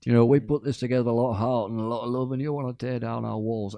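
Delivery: high, indignant promoter impression; mock angry yelling. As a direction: imitate an aggrieved organiser telling off a crowd. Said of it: "the promoter yelling at them".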